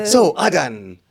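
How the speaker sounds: high, loud excited gushing; Rania impression. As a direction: gush like an excited co-host. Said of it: "this is my impression of Rania".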